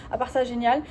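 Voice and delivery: ordinary talk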